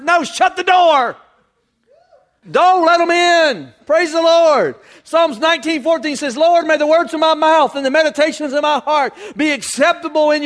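full-force preaching. Real, not acted. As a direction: ring it out to the congregation.

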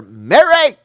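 high, loud call of a name. Acted. Jesus calling to weeping Mary at the tomb, calling out. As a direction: call out to get her attention.

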